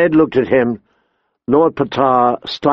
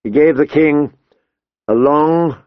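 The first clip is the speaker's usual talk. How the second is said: usual speaking voice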